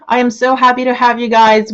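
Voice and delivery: usual speaking voice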